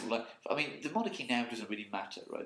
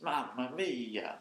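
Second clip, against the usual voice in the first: slow, stretched Italian Mamma mia; drawn-out Mamma mia; much slower than usual. Pretend. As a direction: stretch it like Mario. Mario catchphrase drawn out.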